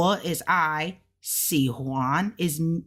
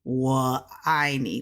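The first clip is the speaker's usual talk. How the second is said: normal talking voice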